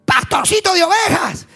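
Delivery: high, loud battle threat; furious shouted taunt at a foe; shouting Goliath. Acted. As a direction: bellow the threat like a charging giant.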